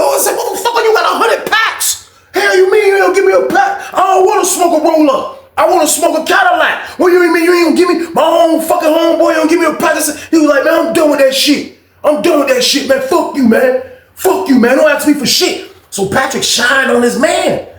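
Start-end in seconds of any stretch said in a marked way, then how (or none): 0.4-10.0 very high-pitched, incredulous mimicked yelling; arguing. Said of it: "They was on that bitch arguing". 10.6-15.7 high, fed-up retold outburst; arguing. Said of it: "They was on that bitch arguing".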